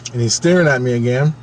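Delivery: ordinary delivery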